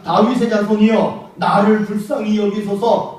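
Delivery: loud pleading cry, drawn out slow. Retold or imitated. Blind beggar on the roadside begging Jesus, loud pleading cry. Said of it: "소리소리 지르냐".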